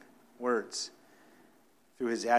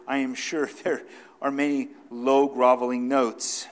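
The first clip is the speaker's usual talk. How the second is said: louder, ringing pulpit read; preaching; 2 semitones higher, 7 dB louder than usual. Acted. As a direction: declaim it to the congregation.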